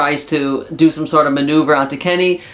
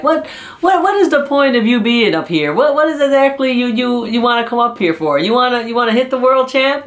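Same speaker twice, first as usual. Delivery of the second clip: higher-pitched mock confrontation; retold challenge, pressing and incredulous; imitated yelling; 7 semitones higher than usual. Wrestler face to face with his rival, imitated yelling. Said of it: "he's really yelling at him".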